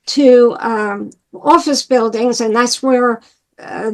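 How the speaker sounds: ordinary talk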